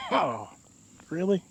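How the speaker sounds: coughing, spluttering after a bug; just swallowed a bug. Real, not acted. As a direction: cough it out, then say it. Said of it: "oh, I swallowed a bug".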